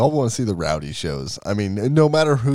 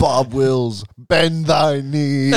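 slow, grand royal command; megalomaniac rant; 4 semitones higher, 4 dB louder, much slower than usual. Pretend. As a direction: order a rival to kneel. Mock king commands rival.

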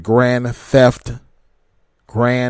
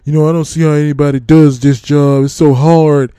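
lightly mocking, louder complaining trucker; whiny complainer; 4 dB louder than usual. Mocking drivers who say trucking is hard, whiny. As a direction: moan about the job like a sulky newbie. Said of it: "these are the guys who get so upset".

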